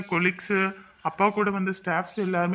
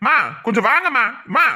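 raised, louder voice, rushing to intervene; urgent remonstrating; 4 semitones higher, 5 dB louder, faster than usual. Acted. Man stopping two quarrelling women, urgent raised voice. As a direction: call out urgently, higher and loud.

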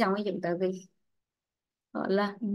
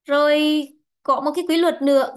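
ordinary talk

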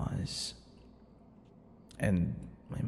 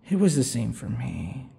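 normal talking voice

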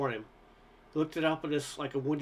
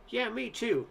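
cried out; 8 semitones higher, slower than usual. A narrator's reading. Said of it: "Kenny cried".

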